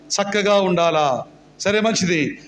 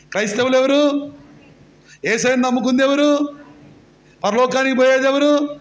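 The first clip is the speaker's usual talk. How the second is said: ordinary talk, nothing marked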